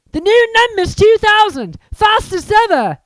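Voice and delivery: very high, loud awe; excited boy. Acted. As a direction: gush over the broom. Boy admiring broomstick.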